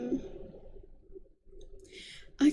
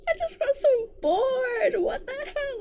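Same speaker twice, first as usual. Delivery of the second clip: high-pitched whine of boredom; exasperated complaint; 10 semitones higher than usual. Real, not acted. Reader complaining about a boring series, high exasperated whine. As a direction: wail it high, fed up.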